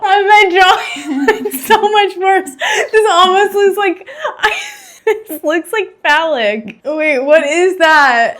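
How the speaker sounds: high, loud, helpless laughing wail; laughing near tears. Real, not acted. Artist horrified by her own worsening drawing, laughing near tears. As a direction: drag the words out through tearful laughter. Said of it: "I'm gonna start crying".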